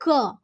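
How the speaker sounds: क alone as a short "kuh"; isolated letter sound. Shown as a demonstration. Teacher answering what sound it will be, isolated sound. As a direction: sound out क by itself. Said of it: "इसका sound क्या होगा".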